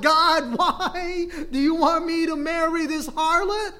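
loud, high-pitched mock wail; pleading protest. Prophet asking God about his wife, pleading protest. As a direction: cry out to heaven in hammed-up dismay. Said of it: "the prophet Hosea was asking God".

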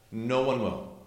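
ordinary talk

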